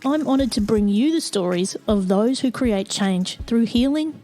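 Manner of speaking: measured announcer read over music; podcast intro read. Acted. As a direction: read it like a polished show opener.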